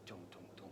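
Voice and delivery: very soft, low creeping beat; soft sinister dum dum. A narrator's reading. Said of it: "The soft, sinister".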